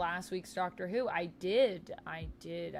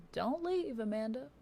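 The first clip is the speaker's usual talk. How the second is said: don't-go plea, pitched way up; high-pitched plea; 6 semitones higher than usual. Real, not acted. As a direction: call out high to stop someone leaving. Pleads with someone not to leave.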